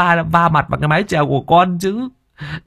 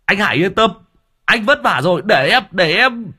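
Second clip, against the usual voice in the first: very high, fast excited shriek; shrieking excitedly; 12 semitones higher than usual. Acted. Servant calling the master about another ghost, high shriek. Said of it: "nó rít lên".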